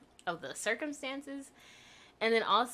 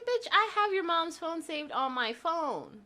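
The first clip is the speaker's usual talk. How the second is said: higher, incredulous put-down; high mocking "are you stupid"; indignant retold retort; 9 semitones higher, 3 dB louder than usual. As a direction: scoff at his stupidity. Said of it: "I said".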